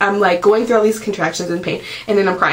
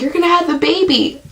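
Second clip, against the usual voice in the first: higher, matter-of-fact nurse impression; 8 semitones higher than usual. Nurse telling the mother in labour she'll deliver now, voiced higher. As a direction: mimic the nurse breaking the news.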